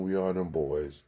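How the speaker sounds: ordinary talk, nothing marked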